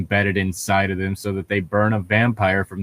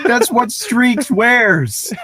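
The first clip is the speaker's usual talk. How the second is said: excited; 12 semitones higher, 4 dB louder, much slower than usual. Real, not acted. Excited fan recognition.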